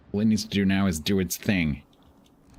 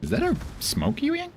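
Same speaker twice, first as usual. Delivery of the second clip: much higher, rising on "a"; squeaky disbelieving query; high-pitched rising question; high surprised question. Shown as a demonstration. Said twice, question then statement.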